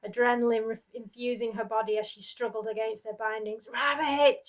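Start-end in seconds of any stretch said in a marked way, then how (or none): 3.7-4.5 loud, higher desperate call; a mother screams to her daughter. Acted. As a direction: scream the child's name. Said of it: "she screamed".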